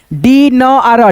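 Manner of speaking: "डी... न... आर" very slowly, long dee first; broken into sounds. Shown as a demonstration. Teacher sounds out dinner for learners, very slowly. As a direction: stretch "डी न आर" into three parts. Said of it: "इसे क्या पढ़ेंगे".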